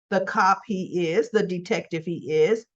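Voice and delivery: ordinary delivery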